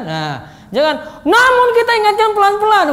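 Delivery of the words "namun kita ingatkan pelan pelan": high and loud where it should be gentle; mistimed loud delivery of "pelan-pelan"; wrong harsh tone. Shown as a demonstration. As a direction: bark a gentle line. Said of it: "posisinya nggak pas nadanya itu".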